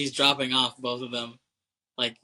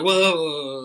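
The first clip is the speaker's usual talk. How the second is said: louder, higher mock alarm; alarmed protest; 5 semitones higher, 7 dB louder than usual. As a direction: put the brakes on someone, startled. Acting out stopping her advances.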